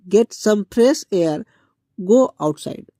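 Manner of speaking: drawn right out, word by word; slow model sentence. Shown as a demonstration. Teacher showing students the English phrase, very slow. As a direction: spell it out slowly for the class.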